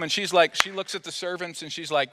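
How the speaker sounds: ordinary talk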